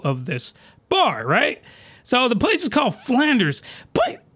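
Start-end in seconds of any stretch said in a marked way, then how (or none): 3.9-4.2 squeaky falsetto, far above his normal voice; voice going high. Real, not acted. Vlogger recapping an absurd hentai scene, voice shooting high. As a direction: voice cracks way up in disbelief. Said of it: "my voice is getting high".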